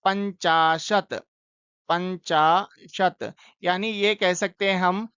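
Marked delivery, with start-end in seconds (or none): 0.0-3.3 पंचाशत said slowly, every syllable clear; teacher modelling पंचाशत slowly for students; slow clear pronunciation. Shown as a demonstration. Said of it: "और पचास को क्या कह रहे हैं?".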